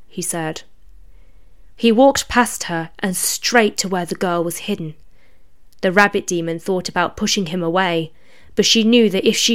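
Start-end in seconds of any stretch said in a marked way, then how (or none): none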